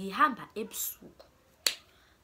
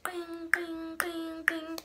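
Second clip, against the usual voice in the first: high sing-song ding ding like a phone alert; imitated message chime; 9 semitones higher, much slower than usual. Mimics phone alert again.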